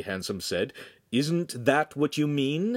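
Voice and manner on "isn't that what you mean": high, slow leading question; suggestive prompting. A narrator's reading. Friend nudges apprentice toward apology, slow and suggestive. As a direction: draw it out as a hint. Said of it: "he added suggestively".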